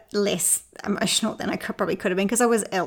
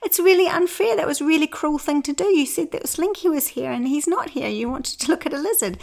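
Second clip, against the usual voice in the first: retold hurt complaint; 7 semitones higher than usual. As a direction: accuse her, half-laughing at yourself. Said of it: "I said".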